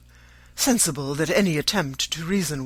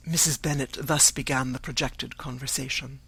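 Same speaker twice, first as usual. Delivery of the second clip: plain reading voice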